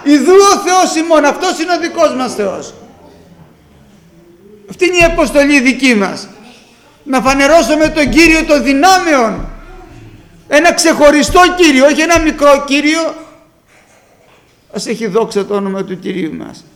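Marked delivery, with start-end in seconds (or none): 0.0-1.2 loud, high cry of proclamation; strong proclamation. Acted. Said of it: "φώναξε ισχυρά". 1.2-13.1 loud, high-pitched preaching at full force; vurige preek. Acted.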